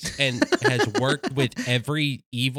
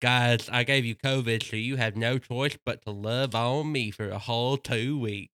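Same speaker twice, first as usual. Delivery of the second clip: slushy lisping dog voice, softer and low; lateral lisp; 5 semitones lower, 4 dB quieter than usual. Retold or imitated. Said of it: "started the voice".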